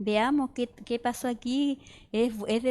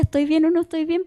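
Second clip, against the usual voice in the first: anxious inner question; 5 semitones higher, 5 dB louder, faster than usual. Retold or imitated. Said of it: "por dentro decía".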